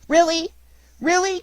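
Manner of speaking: very high, loud squeal of delight; overjoyed puppet, voice leaping up; delighted cry. A narrator's reading. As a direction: cry it out in joyful disbelief. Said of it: "dancing with delight".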